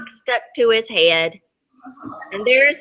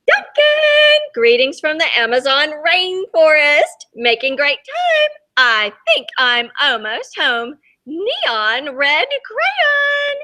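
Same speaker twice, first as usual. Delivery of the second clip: much higher, bright excited postcard voice; excited greeting; 4 semitones higher than usual. Acted. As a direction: call out the news like an eager traveller. Excited postcard from red crayon.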